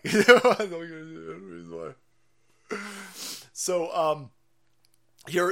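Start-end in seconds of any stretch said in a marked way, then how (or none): 0.0-2.8 slow, laughing don't get in your enemy's way. Real, not acted. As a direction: laugh through it slowly. Repeats laughing.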